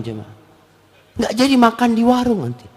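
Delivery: ordinary talk, nothing marked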